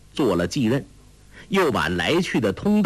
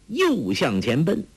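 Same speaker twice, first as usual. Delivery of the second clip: plain reading voice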